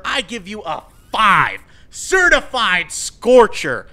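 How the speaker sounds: loud, slow, grand proclamation; mock award announcement. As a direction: crown the hot take like a game show host. Mock award ceremony for hot take.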